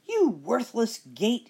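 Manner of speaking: slow, loud witch scream. Acted. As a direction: drag it out in a screech. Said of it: "she screamed".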